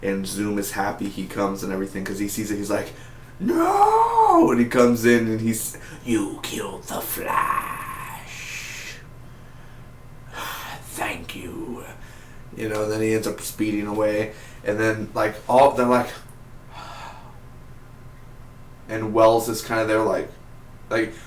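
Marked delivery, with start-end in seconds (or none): 3.4-4.5 shrill high cry, much higher and louder; mock villain scream, way up high; villain's cry. Zoom screaming at Flash's death. 6.0-11.8 very slow, breathy whispered villain voice; imitating Zoom, slow and whispered; raspy villain whisper. 16.1-18.8 imitated sigh. Characters sighing in relief.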